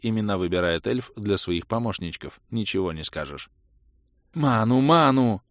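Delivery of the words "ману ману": high, loud, slow call; insistent reminder. A narrator's reading. Spellcaster calls for another potion, insistent. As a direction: call it out drawn long, demanding. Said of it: "Вновь напомнила о себе Кэлен".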